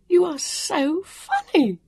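very high, slow, breaking with mirth; weak from giggling, high and drawn-out; helpless laughter. A narrator's reading. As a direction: squeeze the words out through laughter. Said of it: "tears running down her cheeks".

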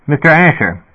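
a dispatcher crackling through the speaker; sputtering intercom voice. A narrator's reading. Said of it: "the speaker sputtered".

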